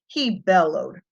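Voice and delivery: usual speaking voice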